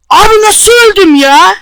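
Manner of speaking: high, loud, slow cry of disbelief; outraged disbelief. Real, not acted. Gamer who just died yelling in shocked disbelief. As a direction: yell it like you can't believe you died. Sudden death in game.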